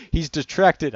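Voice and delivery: loud, high shout to teammates; called-out warning. A narrator's reading. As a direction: call it out urgently, high and loud. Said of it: "I heard Internet chat".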